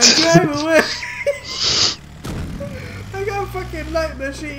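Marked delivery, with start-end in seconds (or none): 0.0-0.8 shouting. Real, not acted. Yelling during a game fight. 0.9-3.1 high burst of laughter; laughing. Real, not acted. Big laugh in the game.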